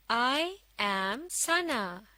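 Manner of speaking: very slow, word by word; slow model reading. Shown as a demonstration. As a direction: read it out slowly for learners to follow. Model reading for learners to follow. (धीरे-धीरे, एक-एक शब्द पढ़कर)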